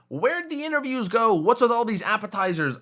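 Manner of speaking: high-pitched mock viewer complaint; imagined viewer's question. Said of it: "If some of you are wondering".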